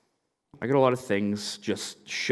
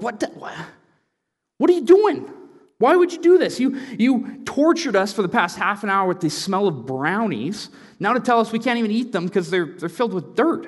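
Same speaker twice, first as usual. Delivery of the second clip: much higher, louder indignant outburst; outraged teen protest; 9 semitones higher, 4 dB louder than usual. Retold or imitated. Boys outraged at their dad over the dirt brownies, indignant yell. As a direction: blast him with disbelief.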